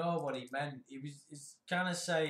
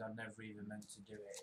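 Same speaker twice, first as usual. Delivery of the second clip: quiet mock quote; 6 semitones lower, 12 dB quieter, faster than usual. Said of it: "that phrase".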